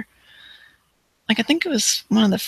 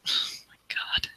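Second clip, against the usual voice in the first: whispering; 8 dB quieter than usual. Real, not acted. Reacting to gory game deaths.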